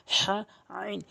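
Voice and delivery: হা, আইন squeezed in the middle of the throat; pressed from mid-throat. Shown as a demonstration. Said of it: "মাঝখান থেকে চাপ দিয়ে". (হা, আইন হলকের মাঝখানে চাপ দিয়ে)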